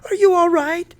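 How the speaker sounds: high, loud anxious question; scared voice. A narrator's reading. Mother checking on her son, scared. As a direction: pitch it way up in alarm. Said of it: "Mama asked in a scared voice".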